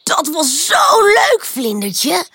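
high, drawn-out delighted exclamation; thrilled. A narrator's reading. Pip to the butterfly after their jumping game, thrilled. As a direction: gush it, stretching the praise.

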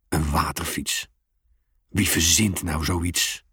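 low, slow, lightly voiced grumbling dad. A narrator's reading. The father grumbles about the silly water bike. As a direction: mutter it down low. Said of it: "moppert hij".

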